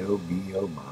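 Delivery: plain reading voice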